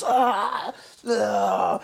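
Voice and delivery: high pained groan; high demon groan. Retold or imitated. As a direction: groan like a hurt spirit. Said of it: "And he goes".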